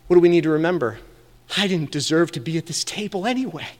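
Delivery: ordinary delivery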